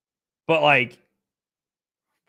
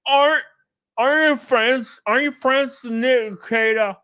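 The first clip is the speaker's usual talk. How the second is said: much higher, odd mocking voice; mock interrupting weirdo; 8 semitones higher, 3 dB louder than usual. Stranger interrupting the group about Rekieta, high mocking voice. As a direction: barge in like a socially lost stranger. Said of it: "they go".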